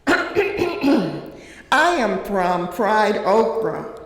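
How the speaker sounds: slow, deliberate poem reading with a light laugh; poem recitation. Acted. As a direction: start the poem slowly, letting each word land. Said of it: "It's called I Am From".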